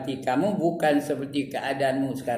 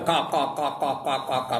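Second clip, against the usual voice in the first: imitating guffaws with kak kak; mock laughter. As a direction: rattle off a mocking laugh. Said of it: "ada orang tengah ketawa".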